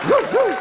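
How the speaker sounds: high-pitched, loud imitated barking; mock dog woof. Crazed fan playing dog, high mock woofing. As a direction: yip like an overexcited dog.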